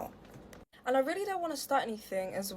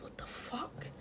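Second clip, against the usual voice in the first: voiceless, very soft whisper; mock stunned whisper; 18 dB quieter, slower than usual. Onlookers' stunned reaction, mouthed.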